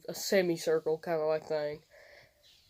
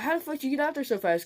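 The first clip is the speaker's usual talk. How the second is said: higher and fast, incredulous; amazed disbelief; 8 semitones higher, faster than usual. Retold or imitated. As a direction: rattle it off like a stunned friend. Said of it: "He was like".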